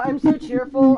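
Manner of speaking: ordinary delivery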